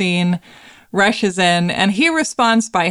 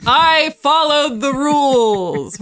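quoting Ready or Not; 7 semitones higher, slower than usual. Acted. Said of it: "We're, we're quoting Ready or Not".

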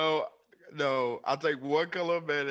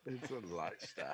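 whisper; 9 semitones lower, 13 dB quieter than usual. Real, not acted. Deadpan whispered quip.

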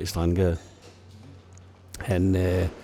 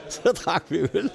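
laughing; 6 semitones higher than usual. Real, not acted. Laughs at his own anecdote.

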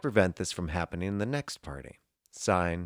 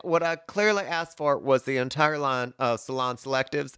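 higher Southern mom fussing over hair products; a hint of a Southern accent, higher; 8 semitones higher, 3 dB louder than usual. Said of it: "Southern accent".

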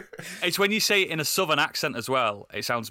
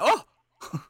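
surprised; 12 semitones higher than usual. Retold or imitated. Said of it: "And I was like".

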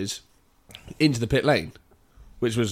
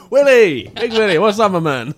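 loud, high, laughing hello; excited greeting; 9 semitones higher, 9 dB louder than usual. Retold or imitated. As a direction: burst out with delighted recognition.

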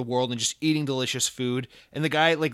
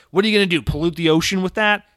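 mocking loud jab; 5 semitones higher, 7 dB louder than usual. Real, not acted. Mocking plastic bottles in a rant.

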